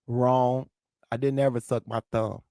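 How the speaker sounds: ordinary delivery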